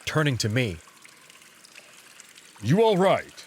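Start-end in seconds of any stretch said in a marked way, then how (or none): 2.6-3.4 louder gruff concern; gruff wolf voice. Acted. Wolf checking on his pet, gruff. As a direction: ask it curtly.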